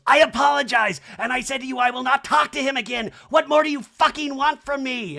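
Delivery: high, loud exasperated mock yell; hammed-up shouted self-defence; Kyle yelling. Castmate defending herself to Dorit, exasperated acted yell. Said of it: "Kyle yells".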